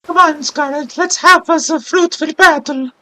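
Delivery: put-on high-pitched girl with an Italian accent. Game trainer challenging Scarlet, high Italian accent. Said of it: "an Italian would say".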